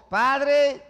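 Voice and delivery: high, loud, drawn-out call; calling out in prayer. Acted. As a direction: call up to the Father. Acting Jesus calling out in prayer.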